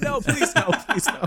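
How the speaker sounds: high mock pleading; anguished denial. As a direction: plead dramatically, half laughing. Joking plea about pairing.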